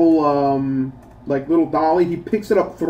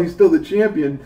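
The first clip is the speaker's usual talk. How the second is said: ordinary delivery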